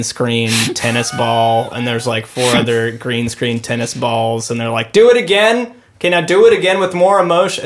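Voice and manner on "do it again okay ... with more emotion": high, loud director barking orders. Retold or imitated. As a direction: call out retakes like a director.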